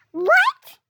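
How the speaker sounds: shrill, loud shocked shout; shocked what. Retold or imitated. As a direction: jolt out a stunned "What?". Shocked reply to sister's news.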